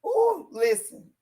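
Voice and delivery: excited ooh. Real, not acted. Gossip teaser.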